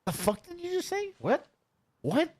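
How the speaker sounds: high, far mock outburst; squeaky put-on voice of a confused guy; mock angry Blade. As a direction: imitate a baffled yell way up high. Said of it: "he gets rolling like".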